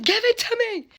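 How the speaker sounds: high, loud mock demand; shrill-pitched, forceful order, hammed-up; yelling. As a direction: imitate an angry aunt yelling. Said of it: "I hear them yelling".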